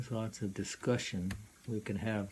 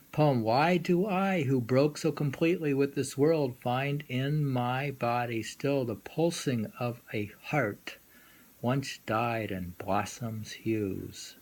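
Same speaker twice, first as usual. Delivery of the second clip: louder, raised start, slowing at the end; poem reading; 3 semitones higher, 4 dB louder than usual. Acted. Lecturer reading Saigyo's poem to the students, lyrical. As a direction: read it with lyrical feeling.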